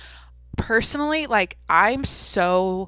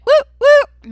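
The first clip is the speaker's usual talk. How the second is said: high, loud whooping cheer; cheering woo; 15 dB louder than usual. Acted. Hosting her people-watching segment with a loud whoop. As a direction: whoop it up like a hype intro.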